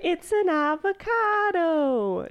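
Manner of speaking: slow, drawn-out meme quote; quoting the meme. Retold or imitated. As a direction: stretch it like the meme. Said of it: "Do you ever know that meme?".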